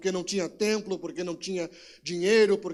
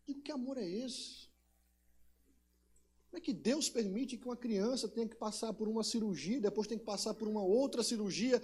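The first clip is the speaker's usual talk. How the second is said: much softer, pained question; hushed anguish; 7 dB quieter than usual. Real, not acted. Father softly questioning God over his son's surgery. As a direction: ask it under your breath in grief.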